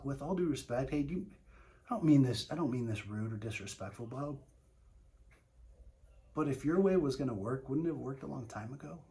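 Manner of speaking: gentle and quiet, respectful; softened. Shown as a demonstration. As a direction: ease off and soften every word. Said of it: "you can soften it".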